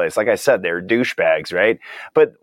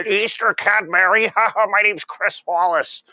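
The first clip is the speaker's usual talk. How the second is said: high, slow cartoon bunny announcer voice with a laugh; Chris Wallace as Cadbury bunny; 9 semitones higher, slower than usual. Mocking Chris Wallace as the Easter Cadbury bunny voiceover, high and slow. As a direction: drawl "Easter Cadbury" like a cutesy commercial voiceover. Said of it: "That guy sounds like the cat, Easter Cadbury, uh, bunny voiceover guy".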